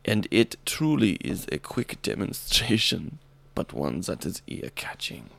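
put-on German accent dropping low and soft; subdued hammy German accent. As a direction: let the mock German voice sink and run out of steam. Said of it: "So hard to do this voice".

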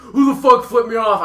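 loud, high angry yell; road rage yelling. Retold or imitated. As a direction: shout it at the kids who flipped you off. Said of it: "and goes".

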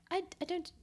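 a light, posh RP imitation of the Queen; Queen impression. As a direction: mimic the Queen's clipped upper-class English. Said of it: "the Queen talks like this".

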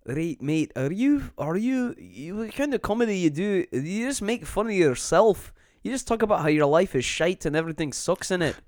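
mock a British passerby in a British accent; British heckler. Comedian imitates a British heckler at the Fringe in a British accent. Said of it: "he was like".

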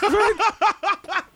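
high-pitched laughing mock "what"; confused Americans. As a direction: squeak it out, baffled and amused. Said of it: "All the Americans are confused".

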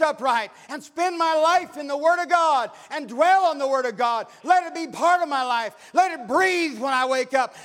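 loud, high preacher's cry; full-force preaching. Acted. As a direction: preach it out to the back pew.

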